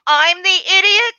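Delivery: loud, high incredulous question; indignant retort. Retold or imitated. Bookseller retells answering a rude customer, indignant and incredulous. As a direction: throw the insult back as a disbelieving question.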